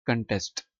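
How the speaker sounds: American "कंटेस्ट" with an unrounded first vowel; American-accent "कंटेस्ट"; American pronunciation. Shown as a demonstration. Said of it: "तो American accent".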